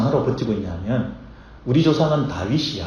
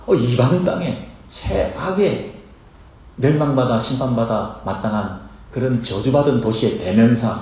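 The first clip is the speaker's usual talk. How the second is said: normal talking voice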